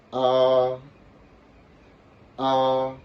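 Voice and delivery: A held high and level; first tone. Shown as a demonstration. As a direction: sing out "A" on one steady high, loud pitch. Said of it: "Donc, ça fait".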